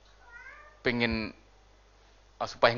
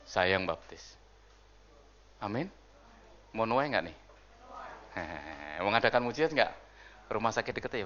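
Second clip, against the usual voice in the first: ordinary delivery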